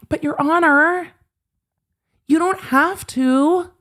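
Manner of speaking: high, slow whiny begging; whiny plea. Pretend. Mocking Ron whining at the judge, high and slow. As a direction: whine it like a spoiled child.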